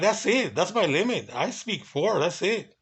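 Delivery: high, swooping protest of defeat; exasperated surrender. Retold or imitated. Guard conceding to the guest he only speaks four, exasperated surrender. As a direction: throw up each short phrase in a high swinging tune.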